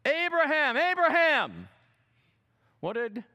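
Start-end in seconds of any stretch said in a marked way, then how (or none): 0.1-1.7 loud, high urgent call; angel shouting. Acted. Angel to Abraham, loud urgent shout. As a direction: stop him mid-strike with a cry. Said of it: "an angel of the Lord shouted".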